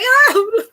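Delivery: high, loud crying "oh"; exaggerated high-pitched boo-hoo wail; mock wail. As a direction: burst into a hammed-up sob.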